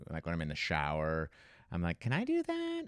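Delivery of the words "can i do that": high Big Nate voice. Retold or imitated. Trying Big Nate voice in shower.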